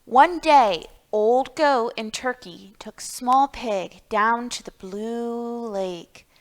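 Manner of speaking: ordinary delivery, nothing marked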